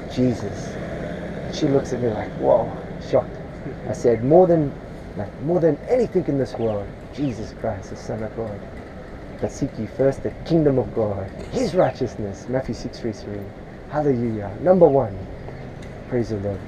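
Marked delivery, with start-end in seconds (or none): none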